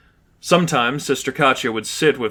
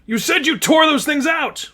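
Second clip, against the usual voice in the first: angry accusation. Acted. Jill accusing Richie, high angry shout. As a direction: demand an answer. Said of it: "Jill shouted".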